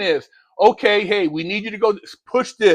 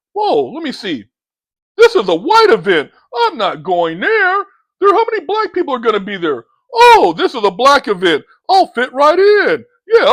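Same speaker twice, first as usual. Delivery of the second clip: hammed-up, louder voice of a guy sizing up who attends; mocking impression; 2 semitones higher, 5 dB louder than usual. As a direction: lampoon someone who picks events by race. Mocks a race-minded event-goer.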